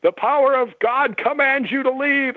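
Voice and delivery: hammed-up preacher casting out a spirit; mock exorcism, drawn out; imitating a commanding prayer. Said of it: "she throws her hands over her head in fists and she goes".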